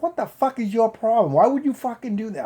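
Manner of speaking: retold angry outburst, pitched high; laying into him. Patient laying into the officer who hurt him, angry. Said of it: "I'm really laying into it".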